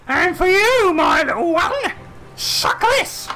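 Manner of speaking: high shouting crone taunt; witch taunt. Acted. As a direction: gloat like a cackling hag. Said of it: "she's like".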